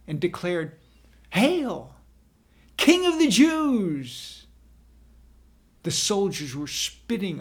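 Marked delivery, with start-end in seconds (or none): 1.3-4.5 loud, high, drawn-out mock homage; mocking hail. A narrator's reading. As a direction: hail him like a fake king, jeering. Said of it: "knelt before Jesus in mock humiliation".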